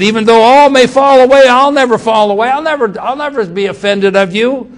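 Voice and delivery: high, loud, fast protesting promise; loud boastful vow. Acted. Peter boasting to Jesus he'll never fall away, loud and high. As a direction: cry it out like a man swearing he'll never fail.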